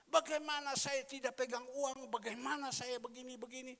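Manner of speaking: loud, high-pitched retold complaint; agitated protest. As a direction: protest loudly, imitating someone at wits' end. Retells a distressed person's protest.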